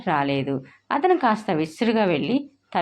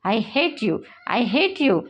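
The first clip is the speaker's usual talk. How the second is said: tearful angry cry; 4 semitones higher, much slower than usual. A narrator's reading. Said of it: "అంటూ అరిచి".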